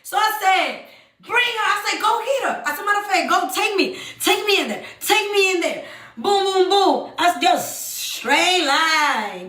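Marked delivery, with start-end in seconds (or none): 1.2-1.7 going crazy. Retold or imitated. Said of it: "So I'm going crazy". 2.0-2.6 going crazy. Retold or imitated. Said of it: "So I'm going crazy". 2.8-5.8 high, urgent demand; going crazy. Retold or imitated. Woman demanding to see the other woman, frantic. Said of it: "So I'm going crazy".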